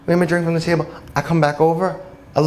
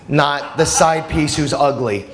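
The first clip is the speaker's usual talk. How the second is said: ordinary talk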